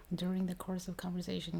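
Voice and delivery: usual speaking voice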